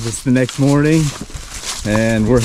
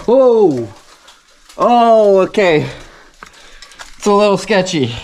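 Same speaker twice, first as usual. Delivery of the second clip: nervous excitement; 6 semitones higher than usual. Real, not acted. Climbing down shaky ladder.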